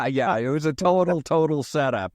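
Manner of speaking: amused admission through laughter; real laughing agreement. Real, not acted. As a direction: laugh into the words. Laughs agreeing it was a setup.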